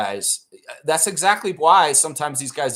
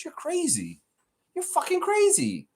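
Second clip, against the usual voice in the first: squeaky, much higher disbelief; mock disbelief. Streamer mocking a fan's prediction, squeaky incredulous outburst. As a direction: pitch it way up in mock outrage at a stranger. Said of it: "I'm like".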